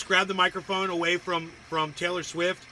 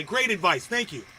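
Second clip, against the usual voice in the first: ordinary talk, nothing marked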